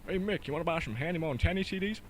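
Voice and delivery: rough-and-ready cartoon impersonation; Goofy. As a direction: have a go at a dopey cartoon sidekick. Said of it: "Goofy, whose impersonation I can't do".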